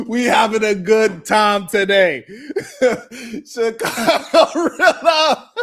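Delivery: real laughter bursting through the words; laughing loudly. Real, not acted. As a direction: crow it loudly while cracking up. Host cracking up at own joke.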